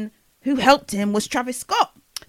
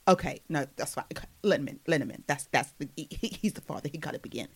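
very soft, low mumbled imitation; barely-there, offhand mock voice; Kylie; 8 semitones lower, 10 dB quieter, faster than usual. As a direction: murmur it low, trailing away. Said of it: "Kylie actually speaks like that".

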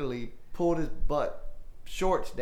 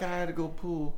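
put-on country kid voice; 2 semitones higher, 4 dB quieter than usual. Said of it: "he said something like".

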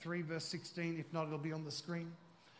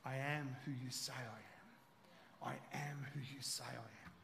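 soft spoken lyric; 5 semitones lower, 8 dB quieter, slower than usual. Shown as a demonstration. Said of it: "I love that song by Hillsong".

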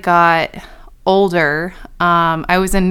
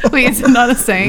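laughing, quick and a bit louder; 5 semitones higher, 4 dB louder, faster than usual. Real, not acted. Checking with friends whether it's a saying, laughing. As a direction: ask it through laughter. Friends doubt her expression.

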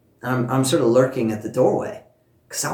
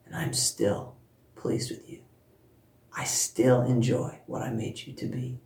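tender voice of God; 5 dB quieter, slower than usual. Retold or imitated. God's words spoken to a daughter.

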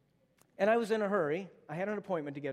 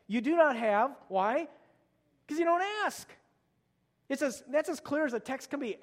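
higher, louder, driving exhortation; a preacher rising to the call, urging prayer; fired-up preaching; 6 semitones higher, 4 dB louder than usual. Acted. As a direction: push the congregation to act. Preaching at full force.

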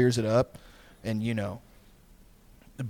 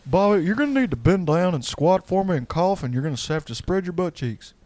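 Hank Hill impression; 6 semitones higher than usual. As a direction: order him like a stern cartoon dad.

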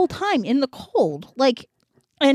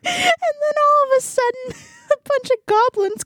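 high, squeaky laughing speech; laughing while talking; 12 semitones higher than usual. Real, not acted. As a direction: fight through the giggles to keep telling it. Cracks up at own story.

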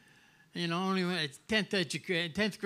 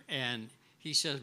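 usual speaking voice

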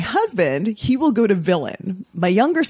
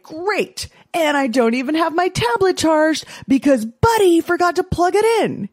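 put-on much higher, sarcastic annoyed boy; sulky kid; 9 semitones higher than usual. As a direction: snipe at your brother. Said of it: "then Felix would be like".

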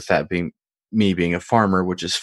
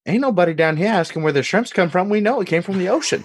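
put-on higher-pitched Deep South country accent; [name]'s Deep South accent; 11 semitones higher, faster than usual. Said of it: "that's the accent".

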